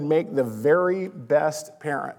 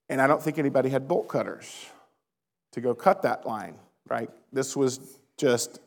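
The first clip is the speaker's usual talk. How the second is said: ordinary talk, nothing marked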